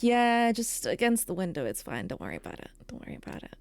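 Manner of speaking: starts plain, fades down to a breathy whisper; mock guy reassurance dying to a whisper; fading to a whisper. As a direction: let it trail off into a whisper. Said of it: "guys and just being like".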